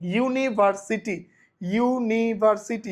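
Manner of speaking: "ইউনিভার্সিটি" said slowly with a clear you sound; pronunciation demo. Shown as a demonstration. Teacher showing students the you sound in university. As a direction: model "ইউনিভার্সিটি" as you-niversity, slow. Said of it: "ইউনিভার্সিটি উচ্চারণ করার জন্য আমি কি করতেছি?".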